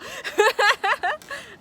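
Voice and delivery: a loud, high burst of laughter. Real, not acted. [name] laughing at her spoiler to viewers, high burst. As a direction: laugh at your own joke.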